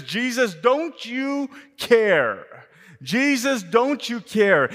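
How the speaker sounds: high, loud, slow plea to Jesus; pleading cry. Acted. Martha crying out to Jesus, loud slow plea. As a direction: drag out the hurt question. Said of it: "She says".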